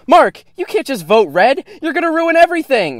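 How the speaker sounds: high, loud panicked pleading; frantic yell. Acted. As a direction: scream it in alarm. Pleading yell at her friend.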